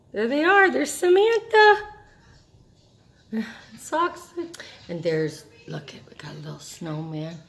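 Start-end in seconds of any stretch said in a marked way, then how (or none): 0.1-4.3 high delighted exclaim; thrilled to spot her; excited. Real, not acted. Excited spotting a photo. 5.7-7.4 hushed whisper. Real, not acted. Quiet aside.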